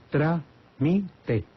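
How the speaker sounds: "trámite" split into three separate syllables, trá-mi-te. Shown as a demonstration. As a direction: count out "trámite" with a break after each syllable. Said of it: "cuente las sílabas". ("trámite" silabeado: trá-mi-te)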